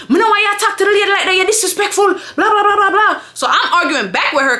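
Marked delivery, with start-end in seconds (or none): 0.1-3.2 high, fast Jamaican patois rant; mock patois telling-off; going off in patois. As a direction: imitate her patois tirade, dissolving into blahs. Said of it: "she just starts going off on me".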